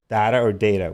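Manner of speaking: "Data" first DAH-ta, then DAY-ta; two pronunciations contrasted. Shown as a demonstration. Co-host asking the chat which, DAH-ta or DAY-ta. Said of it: "Which one is it?".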